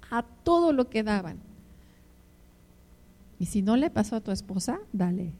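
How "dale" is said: usual speaking voice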